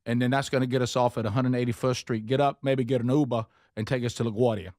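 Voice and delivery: brisk New York local giving directions; imitation New York subway talk; mock New York accent. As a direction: put on a New Yorker's accent. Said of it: "I started talking like New Yorkers".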